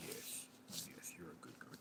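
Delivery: barely audible soothing whisper; hushed, voiceless reassurance; whispered pet talk. Real, not acted.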